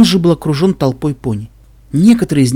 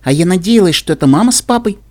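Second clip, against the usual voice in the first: put-on higher-pitched sulky child; pouting; 5 semitones higher, 4 dB louder than usual. A narrator's reading. Filly hoped her parents were back, pouting. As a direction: pout it like a let-down filly. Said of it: "надула губки Сильвербелл".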